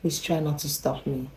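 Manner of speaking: hushed imitation of the neighbor; soft request. Neighbor who hates interruption warns her visitor, softly. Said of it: "just know she's about to say".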